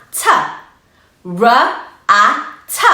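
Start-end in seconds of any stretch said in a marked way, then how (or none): 1.3-2.9 "R", "a", "t" as separate sounds; sounding out. Shown as a demonstration. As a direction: phonics sound-by-sound. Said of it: "Now let's blend it".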